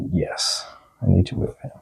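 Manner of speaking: normal talking voice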